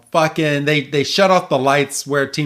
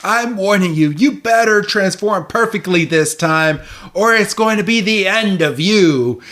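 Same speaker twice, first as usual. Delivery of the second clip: higher-pitched villainess threat; mock scolding; 6 semitones higher than usual. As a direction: scold like a cartoon villain laying down an ultimatum. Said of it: "just starts scolding fucking".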